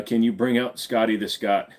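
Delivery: ordinary talk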